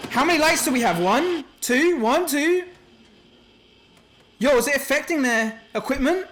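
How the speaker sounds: high, loud, anxious counting; nervous, heart pounding; adrenaline shakes. Real, not acted. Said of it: "my heart is racing right now".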